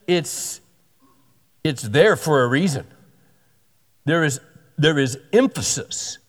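normal talking voice

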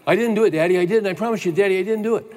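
put-on high, pleading child's voice, rising; a little boy swearing he didn't do it. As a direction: play a small boy begging Daddy to believe him. Son denying lie to dad.

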